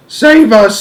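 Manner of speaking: high, loud giant's cry for help; panicked scream. Acted. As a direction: scream it in terror. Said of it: "screamed the flesh lump eater".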